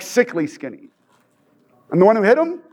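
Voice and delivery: ordinary talk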